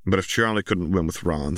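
ordinary delivery

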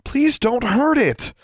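slow, high plea; beg, drawing it out high; high-pitched, distressed begging; pleading. A narrator's reading. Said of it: "Alexandra pleaded".

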